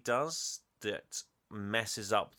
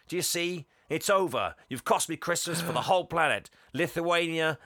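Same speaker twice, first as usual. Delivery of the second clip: rising rant; 7 semitones higher, 5 dB louder than usual. Retold or imitated. Santa rants at Fred.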